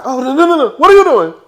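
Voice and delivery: high, loud mock-panicked Giselle squeal. Reviewer imitates Giselle recoiling from Wendy, high and loud. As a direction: squeal it like a flustered diva. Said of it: "Giselle was like".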